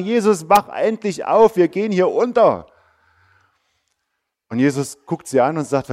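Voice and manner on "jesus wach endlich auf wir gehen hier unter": panicked call. Acted. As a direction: shake him awake, pleading, a little louder. Panicked disciples wake Jesus. (verzweifeltes Rufen der Jünger an Jesus, lauter und langsam)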